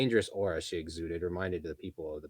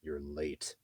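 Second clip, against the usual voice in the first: grunted; 3 semitones lower, 5 dB quieter than usual. Acted. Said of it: "Ghislaine grunted".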